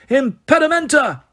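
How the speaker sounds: high, sharp shouted incantation; yelled spell. Acted. Someone stopping the brawl with a spell, yelled. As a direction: fire the jinx with a yell. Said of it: "somebody in the vicinity yelled".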